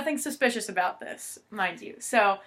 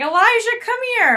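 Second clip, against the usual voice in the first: much higher, louder imitated mom calling across the house; mock calling out; 13 semitones higher, 8 dB louder than usual. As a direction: call him like the mom would. Mom calling son, retold.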